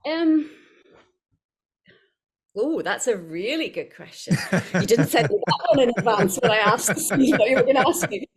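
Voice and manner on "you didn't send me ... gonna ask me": louder, higher, laughing through the words; laughing mock complaint. Real, not acted. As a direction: protest jokingly while laughing. Joking protest at surprise question.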